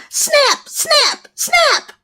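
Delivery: high, spooky snap sounds; child mimicking snaps outside; imitated snap. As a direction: mimic the snapping noise. Child imitates scary noise.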